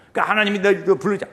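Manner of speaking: usual speaking voice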